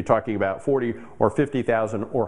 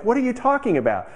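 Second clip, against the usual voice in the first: high, incredulous outburst; baffled retort, pitched up; 13 semitones higher than usual. Retold or imitated. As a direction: react as if the claim is absurd. Dentist baffled by the claim.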